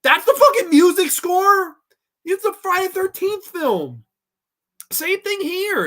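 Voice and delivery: high, loud exasperated yelling; incredulous outrage. Real, not acted. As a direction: cry out in disbelief at how bad it is. Outraged rant at machinery score.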